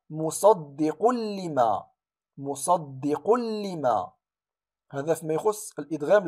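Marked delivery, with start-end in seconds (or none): 0.1-4.1 مصدق لما very slowly, tanween merged into doubled lam; drawn-out recitation, musaddiqul-lima; tajweed recitation. Shown as a demonstration. Teacher reciting the example, slow merge. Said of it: "هنا".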